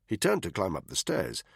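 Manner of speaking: ordinary delivery, nothing marked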